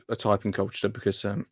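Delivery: ordinary talk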